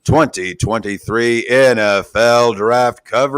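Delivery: slow, booming show opener; announcer intro. Acted. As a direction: announce it like a big event.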